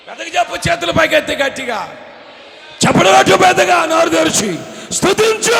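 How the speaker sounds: high shouted exhortation, slowing into long cries; preaching at full force. Real, not acted. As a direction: stir the crowd to loud praise. Rouses congregation to praise.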